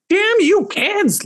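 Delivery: very high, slow, loud scolding; crazed mom yelling. Acted. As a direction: shriek at the kids like a mad mother. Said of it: "stormed into the kitchen with a crazed".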